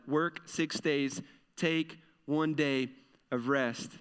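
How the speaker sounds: each phrase slowly weighed; slow emphatic command. Acted. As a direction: spell out the rule slowly, like a commandment. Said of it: "slow emphatic command".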